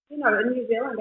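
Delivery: usual speaking voice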